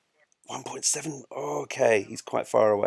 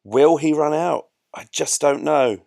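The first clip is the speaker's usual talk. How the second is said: put-on dramatic suspense, raised and loud; mock jeopardy; 4 semitones higher, 9 dB louder than usual. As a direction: ham up the cliffhanger for the audience. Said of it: "bit of jeopardy".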